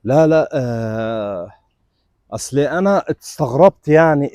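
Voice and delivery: slow, dazed stammer; stunned. Acted. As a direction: fumble for words in shock. Stunned on learning who she is.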